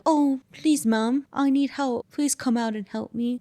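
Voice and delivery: put-on high-pitched child pleading at the door; child's plea. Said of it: "they start telling you".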